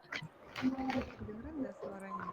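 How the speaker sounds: very faint, slow and muffled; underwater. Real, not acted. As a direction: voice drowned as if underwater. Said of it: "Kamu ngomongnya di dalam air ini?".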